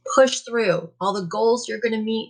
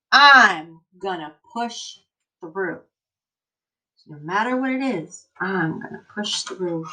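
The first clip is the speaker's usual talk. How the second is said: put-on slow, emphatic read-aloud for kids; reading to children; 2 semitones lower, 3 dB louder than usual. Teacher reading an encouraging book to her class, emphatic. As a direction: read it like a rousing picture book.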